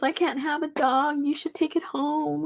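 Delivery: high imitated friend offering the dog; lightly voiced friend, high; mock friend voice. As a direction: hand off the dog in a lifted voice. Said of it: "He goes".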